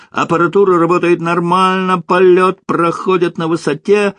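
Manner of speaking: high-pitched pretend cosmonaut radio report; mock flight report. Kostya parodies a flight report in bed.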